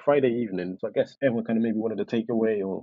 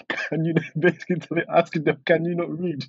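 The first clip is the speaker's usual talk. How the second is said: put-on Indian accent; 6 semitones higher than usual. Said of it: "in a thick Indian accent".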